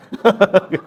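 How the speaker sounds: bright burst of laughter; real laugh. Real, not acted. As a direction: laugh at your own punchline. Laughs at own joke.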